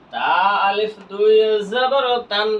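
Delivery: slow, stretched letter-by-letter spelling; teacher's slow spelling drill; drawn-out spelling recitation. Shown as a demonstration. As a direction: spell it out slowly, holding the long vowels. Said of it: "বানান করলাম".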